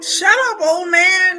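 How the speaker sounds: high, loud mock scolding; acted dismissive shout. Townsfolk shutting up the one who warns, mock angry shout. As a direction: yell down the crazy old doomsayer. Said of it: "And they're like".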